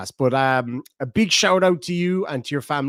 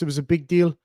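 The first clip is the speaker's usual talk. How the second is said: ordinary delivery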